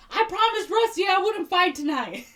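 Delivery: high, loud mock wail; Willard. Willard tells his attackers of his promise, hammed-up yelling. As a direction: cry out helplessly, hammed-up. Willard protests while being beaten.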